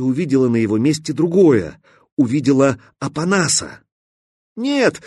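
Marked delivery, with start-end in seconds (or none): 4.6-5.0 a very high scream of panic; panicked scream. Acted. Stalker screams as Katya pulls away, panicked. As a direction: scream in terror. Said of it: "крикнул скворец".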